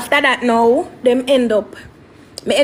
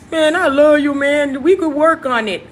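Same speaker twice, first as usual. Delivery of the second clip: imitated pleading; 4 semitones higher, 3 dB louder than usual. Acts out ex pleading for reunion.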